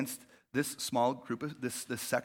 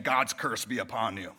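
low, grave mock curse; a hint of a biblical curse; mock solemn curse; 8 semitones lower than usual. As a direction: intone it like an old prophet, tongue in cheek. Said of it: "hear people saying".

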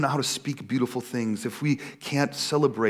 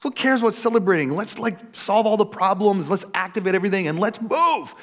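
higher-pitched, exasperated mock complaint; impatient protest; 6 semitones higher than usual. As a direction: act out whining impatience to get on with work. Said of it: "I would just be like".

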